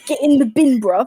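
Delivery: loud mock London slang; British accent. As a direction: echo the British roadman line, loud and punchy. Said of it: "In the words of Simon".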